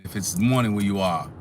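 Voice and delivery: usual speaking voice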